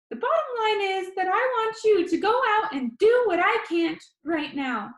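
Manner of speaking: high, loud, chatty vlogger; high squeaky boy. Acted. Danny tells his viewers about his disease in a high squeaky voice. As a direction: rattle it off like an upbeat kid vlogger. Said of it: "His voice was high and squeaky".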